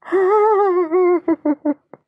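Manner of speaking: high, loud, quavering yelp; stuttering squeal of fright; frightened cry. Acted. Storyteller showing how he'd go in the rain, frightened cry. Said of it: "he'd go like this".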